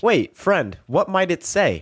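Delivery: mannered video game villager; stilted NPC voice. Pretend. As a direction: call out like a scripted NPC. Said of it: "sound like an N P C".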